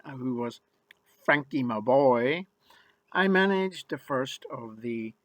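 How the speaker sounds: ordinary delivery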